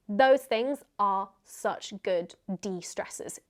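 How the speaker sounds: ordinary talk, nothing marked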